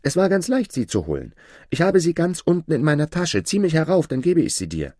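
put-on high, loud boyish plea; young Aladin calling up. Aladin tells the sorcerer above to pull him up, high eager call.

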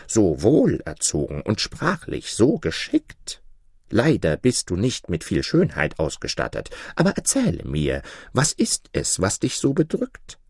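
gracious but condescending lion; low lion voice. Acted. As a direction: speak down to him like a king of beasts. Lion's voice in the tale.

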